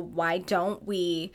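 ordinary talk

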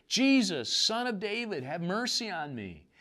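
high pleading cry, retold not shouted. Blind beggar calling out to Jesus, high pleading cry. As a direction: plead for mercy, pitched high. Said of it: "he began to cry out and say".

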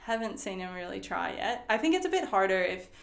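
usual speaking voice

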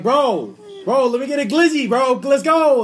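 loud, high, impatient street order; pushy New York shout. Acted. New Yorker showing how locals order, pushy shout. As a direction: holler it way up high, demanding service. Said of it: "how they would say it".

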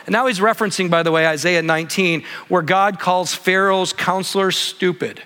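normal talking voice

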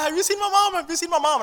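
put-on high, frightened little boy asking around; scared lost child. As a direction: plead like a lost kid in a store. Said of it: "And I'm terrified".

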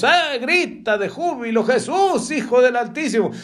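high-pitched acted cries of praise; jubilant mock cry. Preacher mimics someone shouting in church, high jubilant mock cry. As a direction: cry out high, hammed-up, without much extra volume. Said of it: "mire, está dando gritos".

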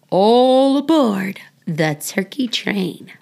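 ordinary delivery, nothing marked

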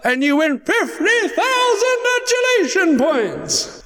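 high, slow prize reveal; grand prize announce. Acted. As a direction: sing out the big prize. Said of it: "bring the princess on".

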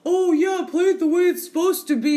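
high, loud mocking purist voice. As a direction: sneer it like a game snob. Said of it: "there are some people that are like".

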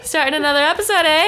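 put-on quick, loud jokey Canadian accent; mock Canadian accent. Host opens another episode in a mock Canadian accent. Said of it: "trying to speak with a Canadian accent".

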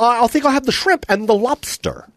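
high-pitched comic impression; Tom Green. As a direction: keep the put-on ordering voice up high. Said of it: "That's exactly what he sounds like".

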